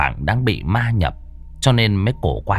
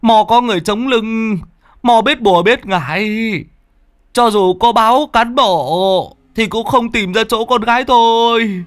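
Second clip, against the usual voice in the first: high, near-tears begging; a father close to crying at the door; choked, high desperate plea; tearful pleading; 9 semitones higher than usual. Acted. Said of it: "rưng rưng năn nỉ".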